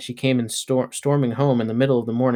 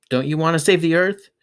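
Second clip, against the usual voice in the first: put-on pleading girl; 6 semitones higher, 4 dB louder than usual. A narrator's reading. Girl arguing with mom.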